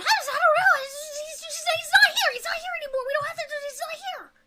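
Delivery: high, frantic pleading creature voice; high panicked goblin babble; panicked hobgoblin. Acted. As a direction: squeal it out in a panic.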